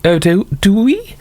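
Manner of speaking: high, slow, lofty mock-aristocrat; lightly hammed-up upper-class sneer; mock posh snob. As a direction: look down your nose at them, drawn out. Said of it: "they say".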